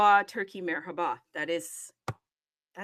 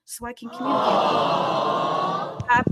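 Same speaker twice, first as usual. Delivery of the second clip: excited shout; 2 semitones higher, 7 dB louder than usual. Real, not acted. Host enthuses about learning French.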